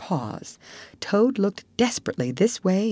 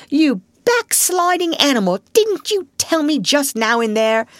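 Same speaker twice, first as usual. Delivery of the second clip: high, louder outraged scolding; scandalized rebuke; 11 semitones higher, 5 dB louder than usual. Acted. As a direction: badger scolds the backsliding Toad. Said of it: "greatly scandalized".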